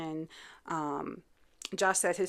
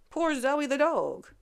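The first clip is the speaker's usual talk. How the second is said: mock pity; 7 semitones higher, slower than usual. Real, not acted. Pity for the dog.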